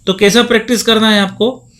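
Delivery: normal talking voice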